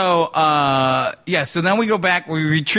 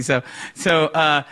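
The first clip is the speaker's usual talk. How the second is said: usual speaking voice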